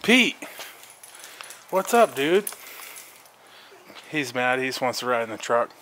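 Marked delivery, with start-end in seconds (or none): none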